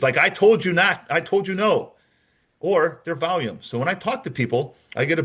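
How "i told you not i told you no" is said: higher, emphatic denial; changed, insistent tone. Shown as a demonstration. Suspect demonstrating a tone change while denying it, insistent. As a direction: insist with a raised, hardened tone. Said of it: "Or the tone changes".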